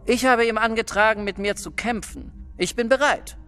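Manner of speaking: high-pitched, heated defiance; indignant challenge. Acted. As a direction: refuse peace and demand a ruling. Acted quarrel, challenger refuses peace.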